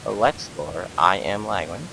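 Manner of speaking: ordinary delivery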